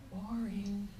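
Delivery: a private, deadpan eye-roll; bored inner thought. Retold or imitated. Unspoken thought about her granddaughter.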